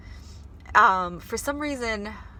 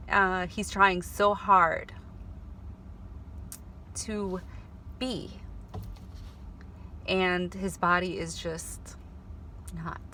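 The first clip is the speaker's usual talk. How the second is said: choking up; 4 dB quieter, slower than usual. Real, not acted. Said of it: "I get so emotional".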